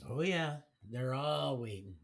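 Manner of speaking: ordinary talk, nothing marked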